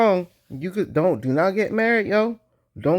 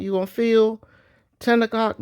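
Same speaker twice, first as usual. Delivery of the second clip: ordinary talk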